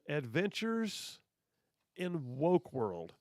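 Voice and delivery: very slow, drawn-out show title; a drawn-out trailer announcer. Shown as a demonstration. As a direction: announce it like a cheesy TV intro. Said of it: "play it for me one more time".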